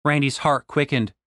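usual speaking voice

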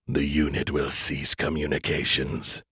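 low, menacing alien entity; cold alien voice. Acted. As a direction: speak as a cold superior alien. Alien creators speak.

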